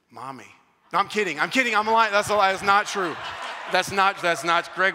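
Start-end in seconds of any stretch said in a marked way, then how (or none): 0.1-0.5 tiny hushed admission, pitched low; low, soft mock confession; meek confession. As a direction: own up very softly, as a retold child. Retells son's meek confession.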